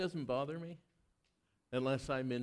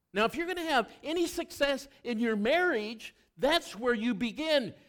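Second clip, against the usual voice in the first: raised, ringing preacher's voice; preaching at full force; 9 semitones higher, 5 dB louder than usual. Acted. Pastor urges married couples to begin with love, preaching loudly. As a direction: pitch it high and loud to the congregation.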